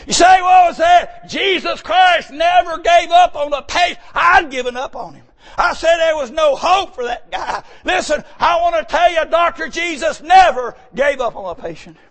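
high, loud hammering sermon cry; full-force preaching. Acted. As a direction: preach it out over the congregation, ringing.

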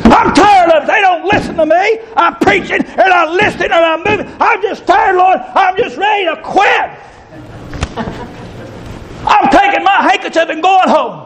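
high, shouted mock lament of a tired preacher; preaching at full force. Preacher acting out every weary preacher, shouted preaching. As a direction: wail it out, half laughing.

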